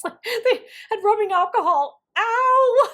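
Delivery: high, loud laughing yelp of pain. Retold or imitated. Counselors dab rubbing alcohol on her cut, laughing yelp. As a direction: yelp through laughter at the sting.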